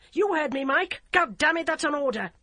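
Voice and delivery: high, loud angry command; furious order. A narrator's reading. DCI furiously orders the constable, high loud command. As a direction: slam him with the order. Emily pulls rank, furious.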